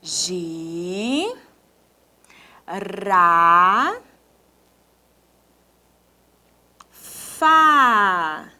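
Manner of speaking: "Gi, ra" long and rising, "fá" falling; very long pauses between "Gi, ra, fá"; dictated syllables. Shown as a demonstration. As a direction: stretch each syllable as if writing along. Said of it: "agora nós vamos escrever aqui".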